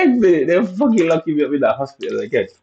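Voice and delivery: high whine easing down as it goes; mock exasperated whine. Repeating the lock-up complaint to viewers, exasperated high whine.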